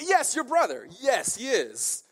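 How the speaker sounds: mock eager agreement. Captives humouring the foolish king.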